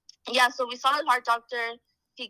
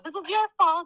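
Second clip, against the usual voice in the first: mock blame; 9 semitones higher than usual. Mock blaming husband after pregnancy.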